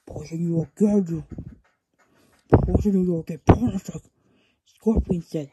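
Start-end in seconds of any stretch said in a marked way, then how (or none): 0.1-3.9 loud, menacing villain threat. Acted. Villain threatening to poison the crow again, loud and menacing. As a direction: promise the punishment loudly.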